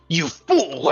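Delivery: lightly voiced pompous old man; a hint of pompous outrage. Game master voices camp boss scolding a henchman, lightly pompous. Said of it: "pompous old voice someone going".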